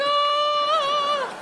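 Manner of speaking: high sung heavenly ah; angelic ah. Pretend. Mocking a priest descending from the ceiling with a heavenly ah.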